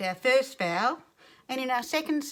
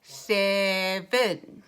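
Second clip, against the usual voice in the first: SEVEN slow, in two drawn-out chunks; robot talk; 4 dB louder than usual. Shown as a demonstration. Teacher saying it together with children, slow robot SEVEN. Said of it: "Say it like a robot".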